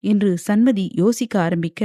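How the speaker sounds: plain reading voice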